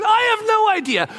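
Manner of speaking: high-pitched exasperated cry; exasperated dad. Acted. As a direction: blurt it high, fed up with questions. Harried dad answering kids' repeated questions.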